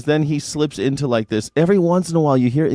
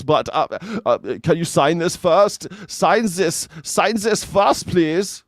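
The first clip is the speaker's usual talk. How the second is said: high, loud, stammering mock Elon Musk; Elon Musk impression; 6 semitones higher, 4 dB louder than usual. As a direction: stutter it out higher and louder, hammed-up. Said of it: "you're like".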